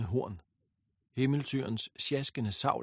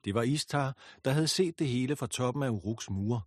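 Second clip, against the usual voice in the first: ordinary delivery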